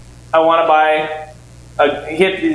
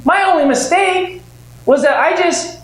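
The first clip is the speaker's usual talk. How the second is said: animated punchline; 10 semitones higher than usual. Real, not acted. Rueful punchline to audience.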